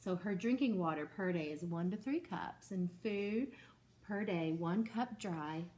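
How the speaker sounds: ordinary talk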